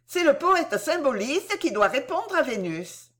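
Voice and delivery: ordinary delivery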